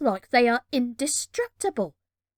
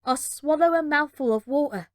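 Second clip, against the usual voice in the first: ordinary delivery, nothing marked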